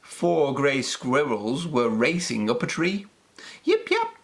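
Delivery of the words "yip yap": squeaky little puppy bark; high puppy yip. A narrator's reading. Storyteller barks as little Peppy, squeaky high yip. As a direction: yip it out high like a tiny pup. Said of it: "barked Peppy".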